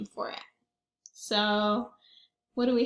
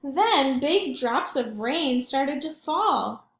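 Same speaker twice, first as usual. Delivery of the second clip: usual speaking voice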